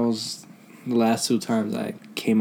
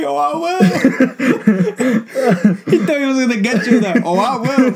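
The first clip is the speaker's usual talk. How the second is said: mocking snooty voice; 11 semitones higher, 6 dB louder than usual. Friend mocking the smug customer, high laughing sneer. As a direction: parody his smug reply.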